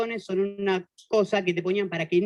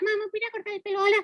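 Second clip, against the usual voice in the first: put-on much higher, small child's pleading voice; little kid asking; 12 semitones higher, faster than usual. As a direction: ask mom like a little boy. Niño le pide otra vez. (niño pidiéndole a la mamá con voz aguda)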